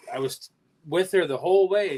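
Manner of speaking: ordinary talk, nothing marked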